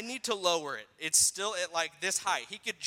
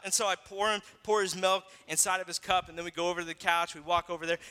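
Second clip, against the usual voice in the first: normal talking voice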